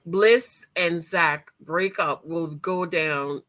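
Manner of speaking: ordinary talk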